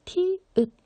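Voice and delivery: 티읕 said high and loud; correct pronunciation. Shown as a demonstration. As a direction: stress the final 읕 in 티읕. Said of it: "티귿이라고 발음하는 사람이 있는데 안 돼요".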